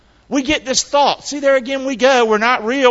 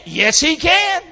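normal talking voice

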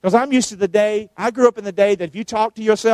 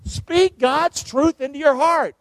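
usual speaking voice

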